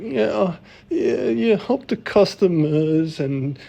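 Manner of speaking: hammed-up shaky eager-to-please answer; nervous interview answer. Retelling his own shaky interview answer to his co-host, mock nervous and high.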